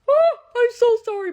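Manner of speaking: loud, high fake apology; sarcastic sorry.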